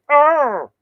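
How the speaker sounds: high, drawn-out puppet frog voice; poor but game frog-puppet impression; Kermit the Frog. Shown as a demonstration. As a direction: squawk it like a cartoon frog, pitched right up. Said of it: "in the sound of Kermit the Frog".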